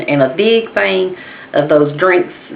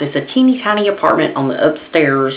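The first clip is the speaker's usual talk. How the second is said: ordinary delivery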